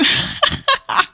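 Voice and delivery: high breathy laugh. Real, not acted. Player amused by her own rocks line, high laugh. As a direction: crack up in a high, breathy burst.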